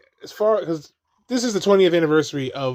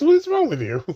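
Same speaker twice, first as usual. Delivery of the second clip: high mock exasperation; 12 semitones higher than usual. Scolding the sad Yoda scene.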